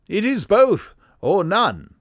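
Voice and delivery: high, loud English insistence; firm, high-pitched English gentleman; Sherlock Holmes. Acted. Detective insisting his friend stays, high-pitched English voice. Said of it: "his high-pitched, very English voice".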